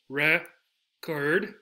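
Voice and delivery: record in two beats, "reh ... kerd"; record, pulled apart with a rising end; split into syllables. Shown as a demonstration. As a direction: pause inside the noun record. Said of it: "Two syllables".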